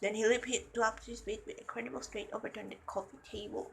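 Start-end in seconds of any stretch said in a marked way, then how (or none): none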